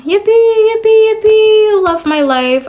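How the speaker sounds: high, loud fake celebration; sarcastic cheering. Cheering her miserable life to viewers, sarcastically elated. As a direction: squeal it with forced joy, then come down.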